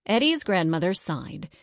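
ordinary delivery

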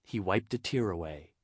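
plain reading voice

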